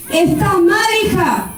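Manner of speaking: holy indignation. Retold or imitated. Preacher scolding the sister, indignant. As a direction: scold her with righteous zeal. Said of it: "me agarró una era santa".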